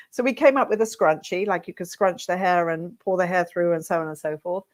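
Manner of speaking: usual speaking voice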